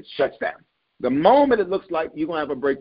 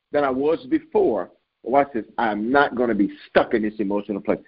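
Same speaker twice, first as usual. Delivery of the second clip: ordinary delivery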